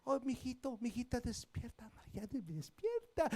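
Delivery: very soft, coaxing parent voice; soft wake-up. Shown as a demonstration. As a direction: coo it like waking a sleepy child. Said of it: "no entra al cuarto y le dice". (susurro tierno de padre)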